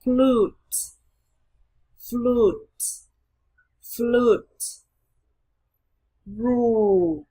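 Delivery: "Flute", "Rule", "Shoe" with drawn-out vowels; long U drill. Shown as a demonstration. Teacher modelling practice words, "Flute", "Rule", "Shoe" long and clear. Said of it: "luyện tập nói các từ sau".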